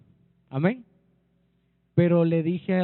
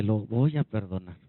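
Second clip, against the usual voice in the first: low-pitched acted voice of God; low, restrained divine resolve; God resolving to forgive; 7 semitones lower than usual. Voices God swallowing pain, forgiving.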